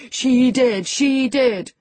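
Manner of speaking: high, loud singsong gloating; singsong taunt. A narrator's reading. Boy enjoying his cousin's agitation, singing out tauntingly. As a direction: chant it to needle her. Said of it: "sang Linton".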